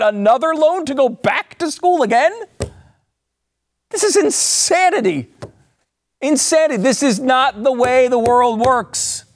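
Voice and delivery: sarcastic outrage. Real, not acted. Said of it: "I'm being very sarcastic".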